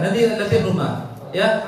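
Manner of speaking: normal talking voice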